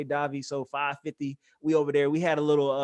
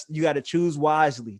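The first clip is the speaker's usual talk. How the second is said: ordinary delivery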